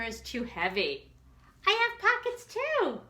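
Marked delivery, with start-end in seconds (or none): 1.7-3.0 second character in a high eager voice; higher voice. Acted. Second friend telling the first, in a high chirpy voice. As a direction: pipe up high and bright.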